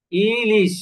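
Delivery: ইলিশ split into ই and লিশ; syllable by syllable ইলিশ; said in two steps. Shown as a demonstration. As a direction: say ইলিশ as two clear beats, ই then লিশ. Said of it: "আমি দুই স্টেপে উচ্চারণ করতেছি".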